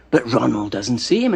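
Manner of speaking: plain reading voice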